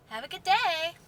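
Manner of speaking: over-bright sarcastic farewell; sarcastic cheer. Acted. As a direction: dismiss them with mock cheer. Sarcastic sign-off after a rant.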